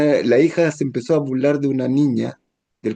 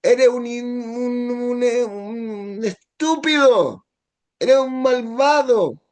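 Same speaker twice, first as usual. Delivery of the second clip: acted angry yell; 10 semitones higher, much slower than usual. Spouse shouting insults at partner, acted angry yell. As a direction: hurl insults at your partner. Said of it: "uno puede gritar al otro".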